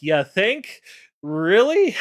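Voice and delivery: high, loud mocking "really"; sarcastic. The five mocking Tyr about his failed plan, sarcastic. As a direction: roll your eyes at the obvious.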